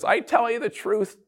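mock-solemn aunt at the holiday meal; dramatic Aunt Irma. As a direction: play the aunt springing news on the table. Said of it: "Aunt Irma stands up and says".

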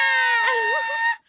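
loud, high mock scream; hammed-up kid shriek; imitated child scream. Son screaming back at his mother, imitated scream. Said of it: "And he's like".

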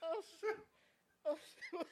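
high, squeezed out through laughing; real laughing fit, barely getting words out; helpless laughter. Real, not acted. Friend cracking up at the joke, helpless laughter.

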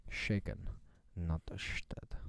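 low, hushed Bond voice with slushy s; Sean Connery. Acted. Quoting Bond lines to a mate, low Connery impression. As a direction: say it deep and slow, s as sh. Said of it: "apart from Sean Connery".

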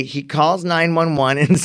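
normal talking voice

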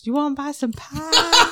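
high, slow mock sales pitch; mimicked Daniel voice. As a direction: copy the squeaky pie seller. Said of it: "in a high-pitched voice".

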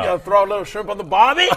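mock Australian, high and fast; mock Australian accent. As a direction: fake Australian slang, half laughing. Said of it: "It's like deep South American".

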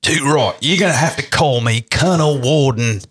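high-pitched, put-on British accent, veering Australian; Churchill. Shown as a demonstration. Co-host requests a British Churchill, wobbly British-Australian accent. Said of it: "Give us a British accent".

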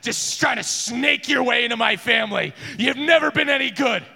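bitter accusation. Acted. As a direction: sneer at the rival. Wilhelm continues ranting at Hardwon.

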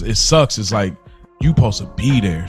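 usual speaking voice

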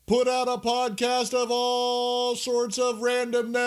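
squeaky high showman voice; high falsetto announcer. Pretend. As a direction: pitch it way up for the finish. Ringmaster intro ends high.

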